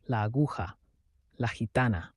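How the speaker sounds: a remembered phrase echoing, soft and low; distant murmur. A narrator's reading. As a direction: let "La aguja" drift away, hushed and distant. Haunted echo of a memory.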